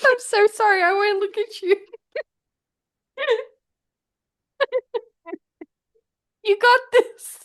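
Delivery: high, laughing apology; giggling. Real, not acted. Can't stop giggling while her partner sings, high and laughing. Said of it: "I can't apparently take it serious".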